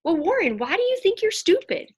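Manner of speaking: curious mom. Retold or imitated. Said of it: "I'll start to say".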